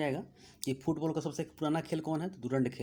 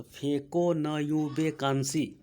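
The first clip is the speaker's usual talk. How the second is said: slow mnemonic; 4 dB louder, much slower than usual. Shown as a demonstration. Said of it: "ये काफ़ी महत्वपूर्ण है".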